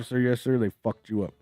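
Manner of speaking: ordinary talk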